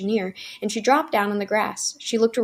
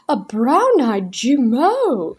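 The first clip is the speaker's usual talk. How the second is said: slow, louder cry of delight; 4 semitones higher, 8 dB louder, much slower than usual. A narrator's reading. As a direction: exclaim in rapture over the doll. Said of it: "she cried".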